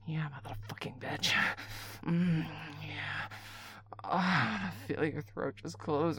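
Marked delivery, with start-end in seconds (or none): 0.1-3.3 fast whisper. Acted. Caller whispering a taunt to a partner, fast whisper. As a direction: rush it out in a whisper.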